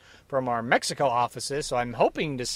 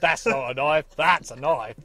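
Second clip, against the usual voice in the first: mock Australian accent. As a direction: quote the film in put-on Australian. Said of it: "neither of us have ever seen Crocodile Dundee".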